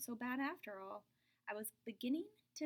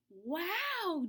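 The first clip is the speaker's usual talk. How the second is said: loud, higher wow of wonder; amazed wow; 7 semitones higher, 7 dB louder than usual. Acted. Jeremy amazed at the big slice of pie. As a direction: marvel at the pie. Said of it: "Jeremy said".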